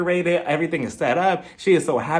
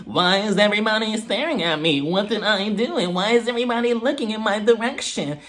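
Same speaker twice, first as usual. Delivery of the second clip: higher put-on voice, self-conscious; uncomfortable guest; 5 semitones higher than usual. Guest to the staring crowd, imitated as uncomfortable. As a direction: imitate a guest uneasy at being stared at. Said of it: "she's uncomfortable".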